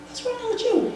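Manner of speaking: high, tight, alarmed question. Retold or imitated. She faces her ex in her doorway, high and alarmed. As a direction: confront him in rising alarm.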